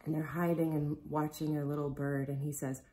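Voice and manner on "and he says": plain reading voice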